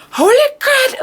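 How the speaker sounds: high-pitched shocked exclamation. Retold or imitated. Reader relives the last sentence of the chapter, shocked. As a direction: blurt it out, stunned by a twist. Reacting to the plot twist.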